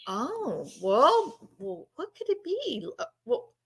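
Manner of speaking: soft, hesitant son imitation; hushed mock bewilderment; puzzled son. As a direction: fumble quietly for an explanation. Acts out son groping for explanation.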